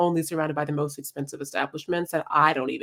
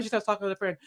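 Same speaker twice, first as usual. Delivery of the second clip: normal talking voice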